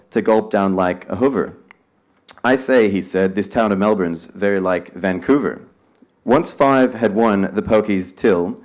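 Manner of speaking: loud, slow, dramatic poem reading; spirited verse reading for a crowd; poem recital. Acted. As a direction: declaim it to the room like a performance. Reads poem aloud to audience.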